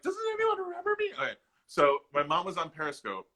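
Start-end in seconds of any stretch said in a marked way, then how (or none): 0.0-1.1 much higher, whiny plea of a forgotten app; pleading app voice. Pretend. Mocking Periscope for being forgotten, a much higher pleading voice. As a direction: beg to be remembered, voice pitched way up. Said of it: "Periscope's like".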